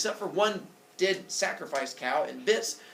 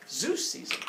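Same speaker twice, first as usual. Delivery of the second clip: ordinary delivery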